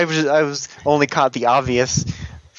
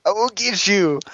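slow, higher, drawn-out villain threat; hammed-up villain vow; 7 semitones higher than usual. A podcaster hamming up a TV villain's vow. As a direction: growl out the name like a cartoon villain. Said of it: "He's like".

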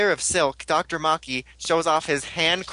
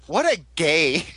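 slow mock line; 2 semitones higher, slower than usual. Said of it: "and Aunt goes".

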